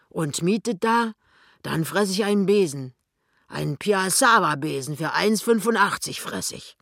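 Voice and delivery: lively, emphatic boastful wager; swaggering disbelief with pitch jumps; blustering shopkeeper husband. Acted. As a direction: swear it like a scoffing tradesman. Voices the fat husband.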